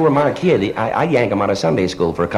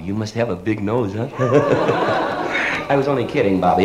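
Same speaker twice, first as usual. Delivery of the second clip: ordinary talk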